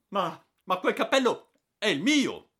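high, loud anguished shout; anguished cry. Acted. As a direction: cry out in distress over the hat. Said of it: "gridando con angoscia". (grido angosciato, alto e forte)

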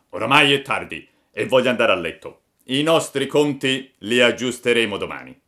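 loud accusing farmer voice; angry farmer. A narrator's reading. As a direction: confront the chicken thief. Farmer gives orders.

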